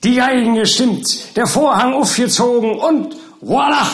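high, ringing mock fanfare; showman's announcement. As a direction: announce the big reveal like a showman. Servant mock-announces the surprise guests.

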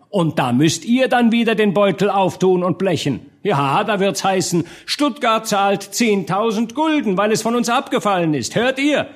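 high, indignant orator's harangue; hoarse crying out. A narrator's reading. Lanky speaker warns Stuttgart's burghers of the cost, crying out. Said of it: "schrie mit heiserer Stimme um Gehör".

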